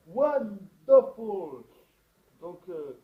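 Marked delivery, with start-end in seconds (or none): 0.1-1.6 slow hammed-up English. English word tossed in for flair.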